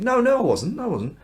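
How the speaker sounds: high, flustered protest falling away; panicked denial. Acted. Peter to the onlookers, a high flustered denial. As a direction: blurt the no, then back off. Peter denies again, cornered.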